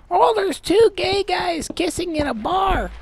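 high, scandalized put-on voice; hammed-up high-pitched complaint; mock outrage. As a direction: imitate a prude who is appalled. Mimics an easily offended person.

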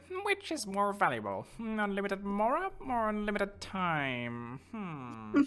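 high, slow musing voice with long hums; mock an NPC pondering a question; pondering NPC impression. Streamer mocking the NPC's question in a silly voice. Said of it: "This dude standing here is like".

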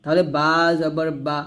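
ordinary delivery

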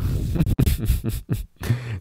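a short shaky laugh after the scary tale; nervous laugh. Real, not acted. Youtuber laughing off his own creepy story, nervous chuckle. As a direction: laugh it off, a little spooked.

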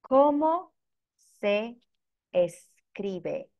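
"¿Cómo... se... escribe?" with a clear pause after each word; teacher's word-by-word model. Shown as a demonstration. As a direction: break "¿Cómo se escribe?" into separate words for learners. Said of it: "here is the question, as you can see on the screen".

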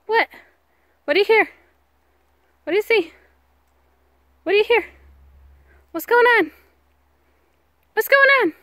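rising squeaky dog-owner questions; high pet talk. Real, not acted. Asking the alert dog.

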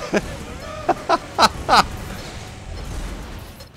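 breathless high laughter. Real, not acted. Player laughing after ruining it for everyone, high laugh. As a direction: crack up helplessly.

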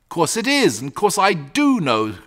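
usual speaking voice

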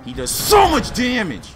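high loud alarm at the damage; alarmed exclamation. Real, not acted. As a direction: blurt it out as the hits land. Boss hitting hard.

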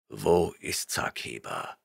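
stern barbarian rebuking a liar; gruff barbarian. A narrator's reading. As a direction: rumble your disgust. Conan's voice.

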